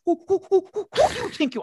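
monkey-like high, loud hooting; Vincent Brown. Acted. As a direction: hoot the words like an agitated monkey caricature. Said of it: "I turned him into a monkey".

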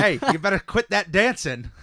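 loud, high hammed-up cop shout; stage cop yell. Cop yells at Ren for dancing in the hallway, hammed-up shout. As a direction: yell it like a cop catching a dancer, half laughing. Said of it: "walked in the school and yelled".

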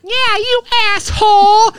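high, drawn-out mock heckle; mom yelling from afar. Mom heckling from twenty yards away, high mock yell. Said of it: "be twenty yards on the opposite and be like".

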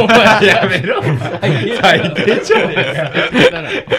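loud laughing protest. Real, not acted. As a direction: laugh while telling them off. Friend insults his girlfriend, laughing protest.

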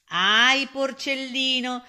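loud, high, stretched cry of pity; mock lament. A narrator's reading. Storyteller pities the trusting piglet, lamenting. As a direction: wail it out as a warning.

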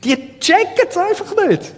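high-pitched, hammed-up exasperation, then dropping back; mock fed-up. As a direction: pretend exasperated outburst, pitch jumping high. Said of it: "Jetzt will man meinen, Jesus sagt, „Ich hab die Nase gestrichen voll".

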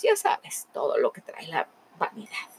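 confiding whispered aside; storyteller whispers a knowing aside. A narrator's reading. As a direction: whisper it like a secret. Aside to the listener.

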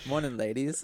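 lightly put-on British greeting; playful touch of British accent; mock British accent. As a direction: greet them like a British charmer. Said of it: "got that British accent".

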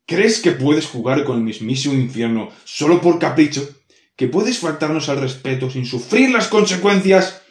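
loud, rising demon reprimand; angry demon scolding. Acted. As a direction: scold her like an offended demon lord. Demon scolds the insolent human.

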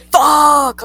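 loud, high drawn-out cry; retold alarm. Child seeing officers at the door, retold alarm. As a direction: blurt it out in mock dread.

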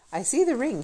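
high excited shout of discovery; excited cry. Acted. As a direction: cry out in delight. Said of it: "he cried".

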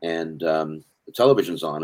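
ordinary delivery

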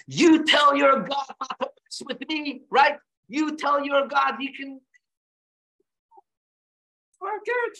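high, slow, defiant shouted threat; angry Paro shout. Retold or imitated. Preacher voicing Pharaoh defying God in a shout. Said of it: "He doesn't stand up and go".

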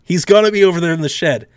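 exasperated logical guy. Retold or imitated. The ignored logical guy pleads with the group, building to exasperation. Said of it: "I'm like".

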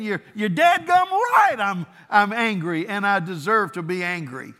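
high, loud hammed-up indignation; indignant Jonah. Jonah defending his anger to God, loud mock outrage. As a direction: huff it out like a sulking prophet. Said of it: "He was like saying".

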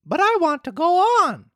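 high insistent puppet voice; insist, high and stubborn; stubborn Pinocchio. Acted.

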